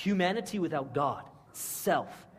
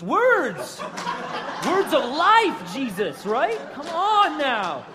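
higher, louder, slow insistent pleading; loud mock protest; 8 semitones higher, 7 dB louder, slower than usual. Peter correcting Jesus, loud slow insistent mock pleading. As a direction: hammer it home, come on now.

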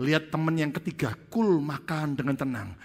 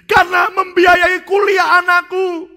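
wailing confession; 14 dB louder than usual. Acted. Pastor confessing the stolen money to friends, loud sobbing wail. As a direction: sob out the excuse. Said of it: "mulai nangis".

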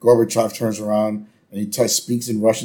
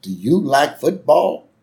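slow, higher-pitched friendly neighbor impression; drawn-out chummy question, pitched up; Gerald Ford; 5 semitones higher, 4 dB louder, slower than usual. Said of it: "And, uh, Jerry goes".

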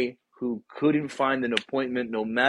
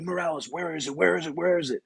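imitating a frantic, higher plea; mock panic, searching desperately; a hint of panic attack voice; panicked pleading; 6 semitones higher, faster than usual. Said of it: "you're having a panic attack".

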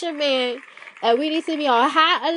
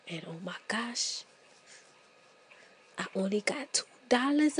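frantic hushed aside; 6 semitones lower, 5 dB quieter than usual. Retold or imitated. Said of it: "And you're like".

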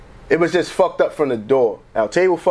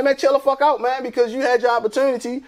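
telling them off; 7 semitones higher than usual. Retold or imitated. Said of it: "And I'm like".

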